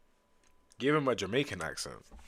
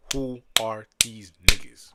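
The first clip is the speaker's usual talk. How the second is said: every word hit hard, spaced out; slow emphatic question; 9 dB louder, slower than usual. Real, not acted. Streamer baffled by newcomers, slow emphatic question. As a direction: drag out "Who are these", loud and slow.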